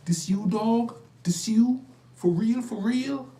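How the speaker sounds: put-on high, loud hyped-up young guy; street slang impression. Imitating the young man greeting him on the sidewalk, high and loud. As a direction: crank it up high and excited like the kid on the street.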